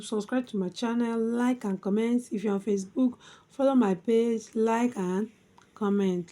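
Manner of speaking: ordinary talk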